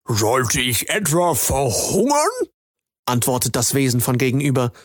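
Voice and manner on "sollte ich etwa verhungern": a sly frog drawing out his words; frog creature. Acted.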